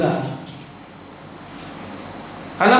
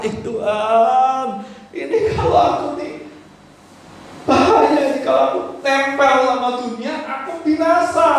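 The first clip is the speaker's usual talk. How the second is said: higher, urgent plea with a nervous laugh; higher, pleading warning to God; anxious prayer; 8 semitones higher than usual. Acted. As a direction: pray like someone scared of the world. Acted prayer of a fearful believer.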